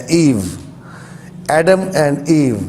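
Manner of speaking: ایو said as English eev; ایو like English Eve, one long ee; English pronunciation. Shown as a demonstration. Teacher gives viewers the English ایو, like Eve. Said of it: "ایو کا تلفظ دیا ہے".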